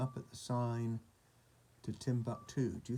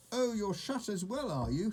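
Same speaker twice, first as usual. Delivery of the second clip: high, huffy tag question; indignant reproach; 9 semitones higher than usual. A narrator's reading. Old sheep giving up on second shopkeeper, huffy reproach. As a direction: start high and make it pointed.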